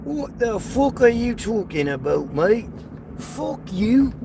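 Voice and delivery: high-pitched British "mate" impression; mock British accent. American mimics a British man cursing at him, mock British accent. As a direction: put on a British accent for a playful insult. Put-on British insult to viewers.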